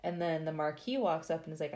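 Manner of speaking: ordinary delivery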